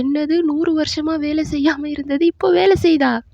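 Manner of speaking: high-pitched retold outcry; astonished yell. Dava reacts to the hundred-year secret, astonished yell. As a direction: exclaim it high, in disbelief. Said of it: "மறுபடியும் Tao சத்தமா கத்துவான்".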